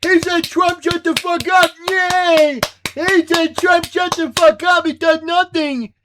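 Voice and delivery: high, loud, gleeful dimwit cheering; mock cheering fan. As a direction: whoop and parrot it like a starstruck crowd. Mocking the cheering audience.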